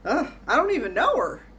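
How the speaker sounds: put-on old man's quip. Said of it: "And he goes".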